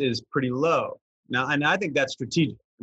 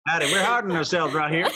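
light mock Southern accent through a laugh; a hint of Southern twang while laughing; laughing Southern drawl; 4 semitones higher than usual. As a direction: lean into the Southern drawl, giggling. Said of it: "your Southern drawl".

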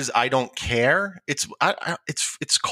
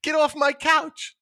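mock indignation; 13 semitones higher, slower than usual. Acted ordering friend off couch.

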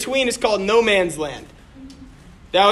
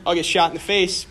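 ordinary delivery

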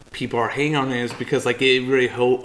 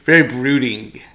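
ordinary delivery

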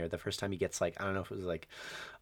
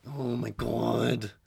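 slow, drawn-out dread; slow mock dread; much slower than usual. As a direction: sink into horror as he arrives. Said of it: "and you're like".

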